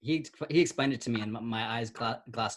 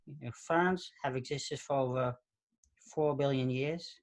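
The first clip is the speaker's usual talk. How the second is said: put-on museum recording in a New York accent; casual put-on New York accent; thick New York accent. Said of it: "a super thick New York accent".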